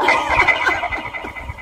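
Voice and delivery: high chipmunk giggle; squeaky effected laugh. Real, not acted. Laughing at her own altered voice. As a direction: laugh through the pitch effect.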